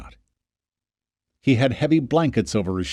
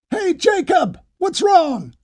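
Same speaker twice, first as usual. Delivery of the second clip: much higher call from below; shouted up; slower than usual. Acted. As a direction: shout up to the wall, worried. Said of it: "someone shouted".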